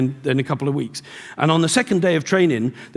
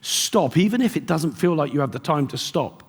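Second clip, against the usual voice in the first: usual speaking voice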